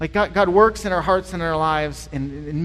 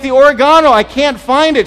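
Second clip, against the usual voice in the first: loud, higher call across the house; shouting back; 4 semitones higher, 10 dB louder than usual. Retold or imitated. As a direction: yell it again so she hears. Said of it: "I'm like".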